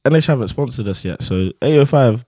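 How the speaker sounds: ordinary talk, nothing marked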